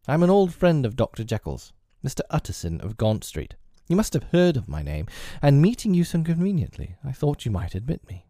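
put-on a courteous old gentleman asking to be let in; earnest lawyer Utterson. Utterson voiced, higher than narration.

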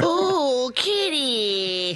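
high, drawn-out cooing squeal; mock excitement. Teasing a cat fan's excitement at a movie, high cooing. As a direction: coo as if spotting a kitten. Mocks friend spotting a cat extra.

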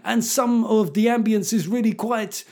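high mock garage MC chant. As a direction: hype the crowd like a put-on garage MC. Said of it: "the M C was going".

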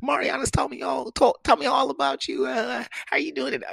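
high-pitched, gushing welcome; girlfriend's mama. Retold or imitated. Mama meeting her daughter's boyfriend, high gushing voice. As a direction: imitate a delighted mother in a high voice. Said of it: "She's like".

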